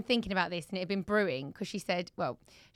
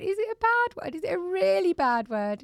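high, louder tearful mock plea; imitated sobbing child; 10 semitones higher, 4 dB louder than usual. Mum retelling her sobbing daughter's question, high mock wail. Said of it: "she was sobbing".

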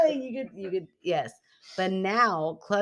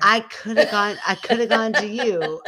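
giggling through the line; laughing; 5 semitones higher, 8 dB louder than usual. Real, not acted. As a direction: laugh while saying it. Laughing repeat.

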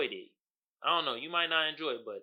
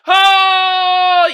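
excited shout; 13 semitones higher, 17 dB louder than usual. Real, not acted. Sudden memory, shout.

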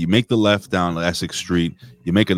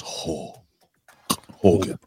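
"Hulk Hogan" whispered, drawn apart; breathy whisper; 3 semitones lower, 4 dB quieter, much slower than usual. Retold or imitated. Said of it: "so he goes".

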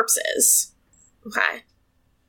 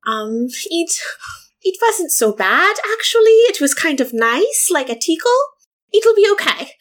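lightly voiced character, higher and lilting; reassuring character voice; 6 semitones higher than usual. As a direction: play it down in a higher voice. Playing hurt character reassuring a companion.

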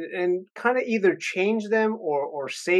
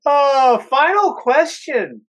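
laughing; 11 semitones higher, 7 dB louder, slower than usual. Real, not acted. Laughing after the joke.

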